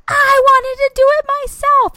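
very high, loud mock wail; hysterical child. Son at the front door to mom, hysterical mock scream. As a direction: imitate a kid's full-blown tantrum shriek. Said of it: "started hysterically crying".

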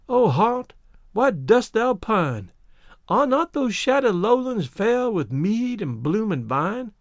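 slow, rapt recital of verse; thrilling, drawn-out poem delivery; poem recitation. A narrator's reading. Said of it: "in rather thrilling and more deeply pitched tones".

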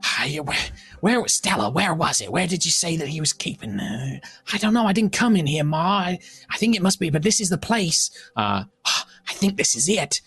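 lightly voiced squabbling burglar family; bickering intruders. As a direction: hurry Ma along, fretting over the job. Game master voices squabbling intruders.

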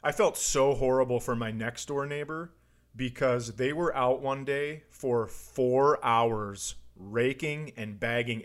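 usual speaking voice

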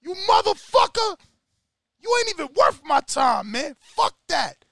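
hammed-up street yell at the traitor; Rocky yelling. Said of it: "Rocky's like".

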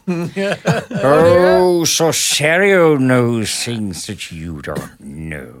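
slow, sly Jonathan Harris-like wizard; wizard Sorcerio. Acted. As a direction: drawl it like a scheming wizard. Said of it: "he sounds like Jonathan Harris".

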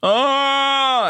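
high drawn-out moaning "Oh" mimicking the roommate; mock hospital-roommate wail; imitated patient's moan. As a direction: moan like the guy in the next bed, all night. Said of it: "that's what he would do".

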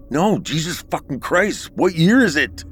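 high frantic yell; panicked yelling. Acted. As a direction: shout it out in disbelief. Narrator panicking at stranger.

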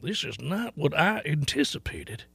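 put-on slow, soft old man voice. Host joins the old man bit about the weather, slow soft old man. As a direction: play a quietly put-out old man, unhurried. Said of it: "That old man is saying".

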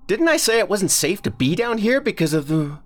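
loud, high angry dad; scolding father. Acted. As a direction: scold the kids, cut off mid-sentence.

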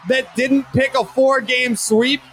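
high, hoarse full-throated yelling; screaming rant. Acted. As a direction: scream it like a furious fan. Sports-pick rant, screaming.

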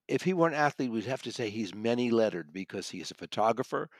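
usual speaking voice

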